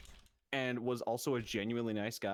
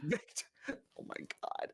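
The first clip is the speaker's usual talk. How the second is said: slow, breathy whispered disbelief; shocked whisper; 5 semitones higher, 3 dB quieter, slower than usual. Real, not acted. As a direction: gasp it out in disbelief. Shocked at Victorville.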